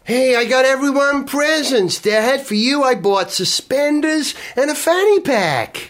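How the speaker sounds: high, chirpy American son; hammy American teen; American accent. Acted. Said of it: "You're not American".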